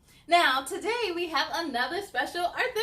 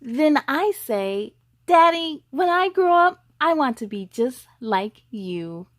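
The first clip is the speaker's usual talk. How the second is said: lively picture-book read, louder; reading to children; 12 dB louder, slower than usual. Acted. Host reads a boy's story to young viewers, louder storybook voice. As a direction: read it out to the little ones, bright and full.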